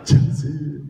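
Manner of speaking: high, loud mock giggly call; giggle, then blurt the name as the kid; imitated giggling. Giggling kid in the background, high imitated laughter. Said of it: "that other kid in the background".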